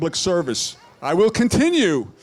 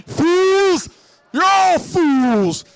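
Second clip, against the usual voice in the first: high, loud villain shout, slow; Skeletor voice; 9 semitones higher, 4 dB louder, much slower than usual. Acted. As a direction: bellow like a cartoon villain. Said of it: "Rick Skeletor for senator".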